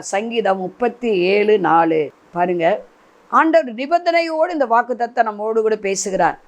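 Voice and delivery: usual speaking voice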